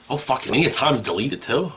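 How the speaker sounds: usual speaking voice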